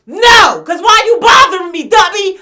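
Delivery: high, very loud furious yell. Retold or imitated. Shouting at the rival during the fight, furious yell. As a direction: shout it in her face. Yelling back while fighting.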